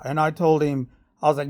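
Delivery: normal talking voice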